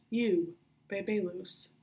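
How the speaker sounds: pirate guard ordering her back; barked. Acted. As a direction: bark at the prisoner. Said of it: "the Mulvanian barked at Fidelia".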